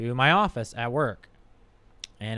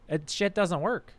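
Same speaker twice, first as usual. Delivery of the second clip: usual speaking voice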